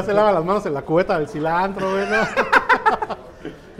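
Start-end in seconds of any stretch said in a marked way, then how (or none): 2.2-3.5 squealing burst of laughter; high laugh. Real, not acted. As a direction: let out a sudden high laugh. Laughs at own joke.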